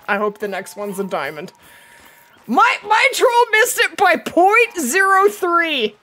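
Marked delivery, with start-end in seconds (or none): 2.5-6.0 loud laugh through the words; cracking up over bad luck. Real, not acted. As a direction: laugh off the near miss. Laughing at near miss.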